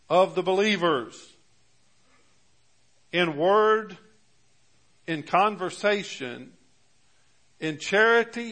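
deliberate pulpit reading with weighty pauses; scripture reading. Real, not acted. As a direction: set out each item of the verse separately. Reads scripture aloud to congregation.

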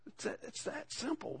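whispered. Real, not acted. Intimate aside to congregation.

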